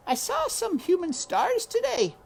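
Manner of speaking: put-on high little girl voice; Meg calling Uncle. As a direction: call him like a small girl.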